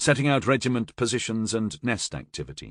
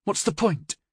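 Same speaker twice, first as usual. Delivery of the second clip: shaky despair; 11 semitones higher than usual. A narrator's reading. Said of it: "she asked in a shaky voice".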